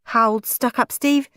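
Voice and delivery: plain reading voice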